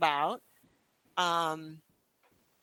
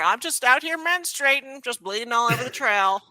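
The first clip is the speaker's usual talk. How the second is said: cheeky mock reply; 5 semitones higher, 6 dB louder than usual. Mock reply to trail comments.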